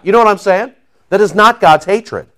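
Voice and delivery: ordinary talk, nothing marked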